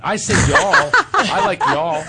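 high, loud Southern twang, laughing; playful Southern drawl on y'all. Shown as a demonstration. He shows his singer's ear, Southern accent. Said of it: "so I'm gonna call it".

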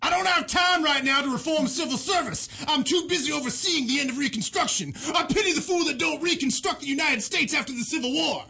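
high-pitched, shouty celebrity-style voice; Rutherford B. Hayes. Acted. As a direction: belt it out as a brash impression. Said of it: "Rutherford, ladies and gentlemen".

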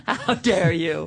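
slow, laughing mock outrage. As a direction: play scandalized, dragging it out.

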